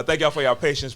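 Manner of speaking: ordinary talk, nothing marked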